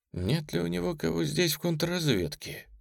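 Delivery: plain reading voice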